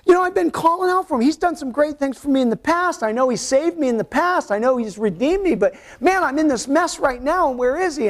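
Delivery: complaining believer. Retold or imitated. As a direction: plead like someone who feels forgotten. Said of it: "and you go".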